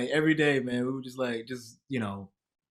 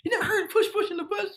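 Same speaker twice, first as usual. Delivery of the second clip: high, laughing disbelief as Leslie; Leslie amazed. Retold or imitated. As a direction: squeal it in mock shock. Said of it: "And she said".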